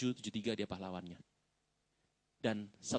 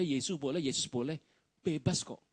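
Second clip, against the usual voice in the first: ordinary talk, nothing marked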